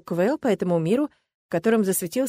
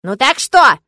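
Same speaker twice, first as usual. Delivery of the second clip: much louder irritated ask; 6 semitones higher, 11 dB louder, slower than usual. Acted. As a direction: press him impatiently. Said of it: "раздражённо спросил".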